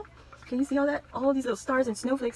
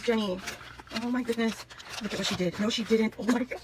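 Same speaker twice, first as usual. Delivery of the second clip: ordinary talk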